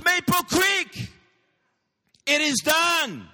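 high, loud triumphant proclamation; full-force preaching. Real, not acted.

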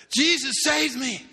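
slow, high, loud cry of joy; joyful exclamation. Retold or imitated. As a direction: proclaim it with elation. Rescued man exclaiming to boss.

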